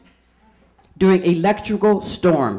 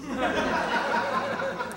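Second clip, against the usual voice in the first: breathy unvoiced laugh at her own joke; airy laugh; 12 dB quieter than usual. Real, not acted. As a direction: let out a silent wheezing laugh.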